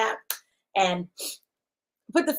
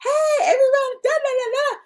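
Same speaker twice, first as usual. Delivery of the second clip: high, louder chirpy host hello with a laugh; mock her own perky intro; bright greeting; 14 semitones higher, 3 dB louder than usual. Imitating her own cheery stream greeting, high and loud. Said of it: "So I'm sitting here going".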